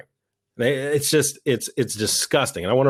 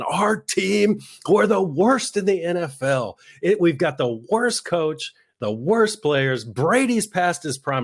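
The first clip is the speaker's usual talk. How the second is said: mock fan complaint; 7 semitones higher, slower than usual. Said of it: "Patriots fans calling in saying".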